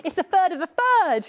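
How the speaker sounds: TH as F in "third", like "furd"; awkward mock Cockney. Shown as a demonstration. Reporter announcing the festival's date, awkward mock Cockney. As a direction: say both "third"s with an F for TH. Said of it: "I sound so awful when I say that".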